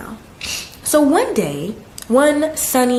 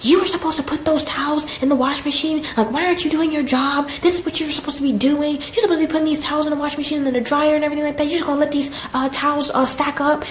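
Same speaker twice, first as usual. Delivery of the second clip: higher, rapid scolding at normal volume; mock yelling; 6 semitones higher, faster than usual. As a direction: rattle off the boss's telling-off. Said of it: "She was yelling at me".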